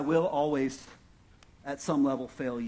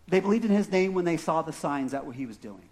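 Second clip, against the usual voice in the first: ordinary talk, nothing marked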